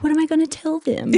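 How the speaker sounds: hammed-up worried whine; high panicked question. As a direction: squeak it out, dreading. Intern dreading the ER.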